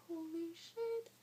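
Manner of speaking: high, slow stunned holy shit; stunned disbelief. Retold or imitated. Fifteen-year-old seeing a positive test, stunned. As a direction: let the shock sink in slowly.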